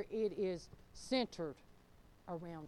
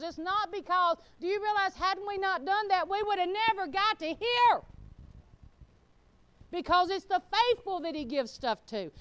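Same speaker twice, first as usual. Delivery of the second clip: high, loud, driving sermon delivery; full-force preaching; 11 semitones higher, 9 dB louder than usual. Real, not acted. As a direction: preach it hard to the whole congregation.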